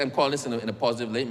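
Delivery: ordinary talk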